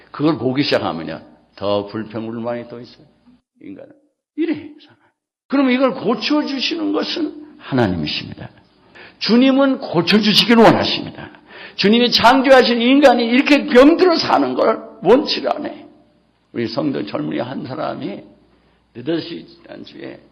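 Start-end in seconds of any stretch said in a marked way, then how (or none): 4.4-15.9 high, loud pulpit cry; fiery preaching. Acted. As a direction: preach it out with full force. Preaching at full force. (힘차게 외치는 설교)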